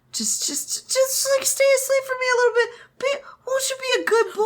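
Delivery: high sing-song cooing like to a pet; cooing baby talk. Retold or imitated. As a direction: coo it like talking to a puppy. Wei Wuxian cooing at sleeper.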